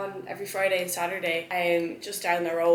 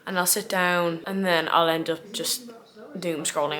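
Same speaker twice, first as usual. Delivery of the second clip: ordinary delivery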